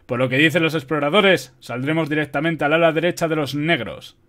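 forceful general briefing his officers; firm, loud military orders; giving orders. A narrator's reading. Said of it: "ordenó a los jefes".

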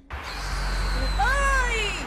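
high, loud barked shout; startled loud "Oi!". Real, not acted. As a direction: yell to get its attention. Shouting at a creature.

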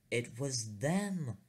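slow, rising alarm at the sight; panicked realisation. Retold or imitated. Narrator spotting the boys in space, mock panicked. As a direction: gasp it out, dread dawning.

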